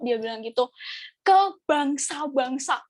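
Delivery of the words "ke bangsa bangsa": very slow, drawn-out proclamation; slow, solemn-style pronouncement; retold prophecy. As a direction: stretch each word out as a prophetic climax. Climax of the pastor's retold prophecy.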